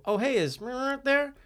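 high, slow, sing-song asking voice; polite visitor. Retold or imitated. Visitor asks his friend's mom at the door, high and innocent. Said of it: "I go".

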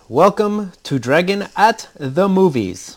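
slow, high, loud announcer read, every word punched; movie trailer voice. Pretend. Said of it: "Good movie voice".

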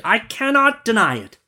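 high-pitched meek merchant voice; merchant's answer. Acted. Merchant confesses to the genie, high character voice. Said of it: "answered the merchant".

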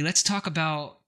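usual speaking voice

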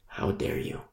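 whispered "How dare you?", breathless and grave; hushed how dare you. Pretend. Preacher confronting the pastor in the parking lot, whispered rebuke. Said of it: "go to him and say".

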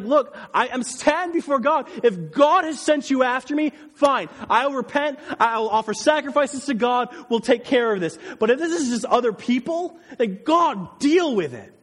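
high, loud, impassioned argument; emphatic paraphrase. Retold or imitated. Preacher voices David answering Saul, loud and insistent. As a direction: plead the case forcefully as David.

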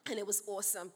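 light Australian accent. Shown as a demonstration. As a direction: say it like an Aussie. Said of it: "That's my Aussie accent".

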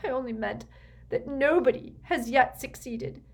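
high, desperate begging; nervous pleading. A narrator's reading. Desperate mother begging Snape to persuade the Dark Lord, pleading. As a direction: implore him, voice pitched well up. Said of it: "seemed to lose her nerve".

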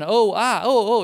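high, loud whimpers of pain; hammed-up pained whining; mock whining. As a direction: whine out each little cry as if hurt. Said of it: "So I'm whining".

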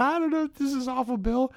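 wife imitated, tearfully pleading with the doctor Bill; imitating crying wife. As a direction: squeak it out like someone near tears. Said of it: "she just starts crying".

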